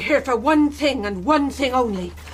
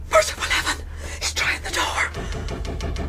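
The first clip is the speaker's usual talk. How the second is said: high gasp, then slow hushed whisper; frozen with fear, barely breathing; terrified whisper; 3 semitones higher, 4 dB quieter, slower than usual. Acted. Mother hearing the blind man at the door, whispering in terror.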